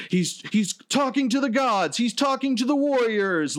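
very high, strained commentator hype; frantic announcers. Retold or imitated. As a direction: imitate wrestling announcers losing their minds. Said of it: "the announcers are like".

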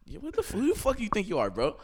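high, fast mock-tough challenge; frat bro. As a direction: get in his face, laughing. Confronting friend unplugging mic.

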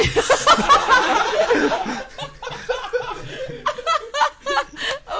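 long helpless laughing fit; can't stop laughing. Real, not acted. Breaks up mid tongue twister.

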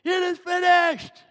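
loud, high triumphant cry; victorious shout. Shown as a demonstration. Preacher shouting Jesus's last words to the congregation in triumph. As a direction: belt it out like a victory. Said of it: "this is a victorious shout".

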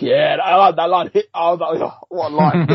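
gushing, laughing mock hype; hyped-up reaction. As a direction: retell being blown away, high and loud. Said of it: "And I was like".

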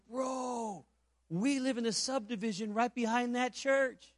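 high, drawn-out exclamation, then animated rush of disbelief; retold amazement. Convert learning of the nearby church, retold as amazed. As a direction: imitate an astonished man's discovery. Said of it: "He's so excited".

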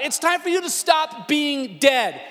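loud, high, forceful wake-up order; commanding shout. Acted. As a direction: preach it as a booming command. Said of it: "He says".

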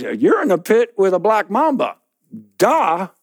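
high, sarcastic stating of the obvious; mocking. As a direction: talk down to him. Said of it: "He says".

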